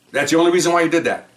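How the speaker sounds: imitating a defense attorney pressing hard; mock incredulous grilling. Attorney grilling the officer, mock incredulous. Retold courtroom cross-examination.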